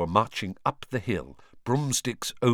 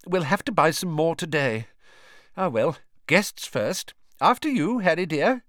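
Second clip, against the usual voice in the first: higher fussing mother voice; motherly voice; 8 semitones higher, 4 dB louder than usual. A narrator's reading. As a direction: kind mother offering, higher. Mrs Weasley voiced, motherly.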